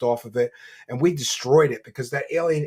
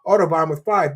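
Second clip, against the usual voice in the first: usual speaking voice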